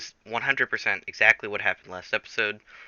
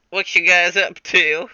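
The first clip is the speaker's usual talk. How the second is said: higher, louder casual greeting; a breezy, put-on hello; put-on Jack voice; 8 semitones higher, 8 dB louder than usual. Jack casually greets the minions in a raised voice. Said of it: "Jack Cooper's like".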